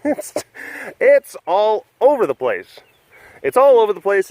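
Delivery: amused laugh at his own rambling. Real, not acted. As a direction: giggle through the admission. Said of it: "Man, this was a strange webcast".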